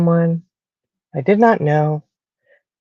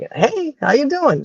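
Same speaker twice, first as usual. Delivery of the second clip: friendly Southern greeting; 9 semitones higher, 3 dB quieter, slower than usual. Retold or imitated. Friendly greeting to strangers in store.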